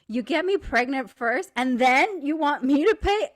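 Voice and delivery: hammed-up indignation, building in pitch and volume; mock outrage. Woman pranking her friend at the checkout, mock outrage.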